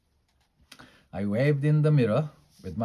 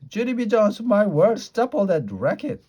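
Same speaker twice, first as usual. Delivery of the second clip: high, loud scolding woman; cross old neighbour's high-pitched outburst; grouchy neighbour scolding; 12 semitones higher, 8 dB louder than usual. Acted. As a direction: yell at a noisy kid, pitched way up. Grouchy neighbour scolds the noisy child.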